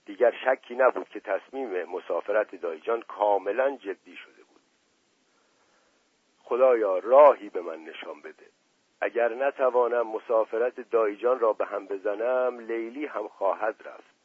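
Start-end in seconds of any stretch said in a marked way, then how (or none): none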